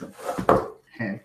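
honking nose blow. Real, not acted. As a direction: blow your nose into a tissue. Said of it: "il faut que je me mouche".

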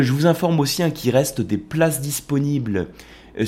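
usual speaking voice

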